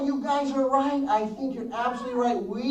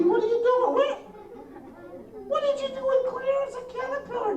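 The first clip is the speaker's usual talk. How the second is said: mock alarm; 12 semitones higher, faster than usual. Pretending the caterpillar vanished.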